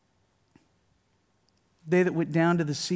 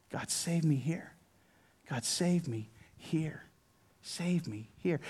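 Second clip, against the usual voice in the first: very soft, halting personal plea; intimate, subdued testimony; hushed prayer; 10 dB quieter than usual. Real, not acted. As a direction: pray it quietly with long pauses. Quiet personal prayer after preaching.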